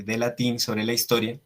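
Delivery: ordinary talk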